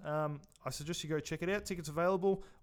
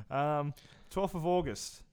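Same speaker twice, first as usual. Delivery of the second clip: normal talking voice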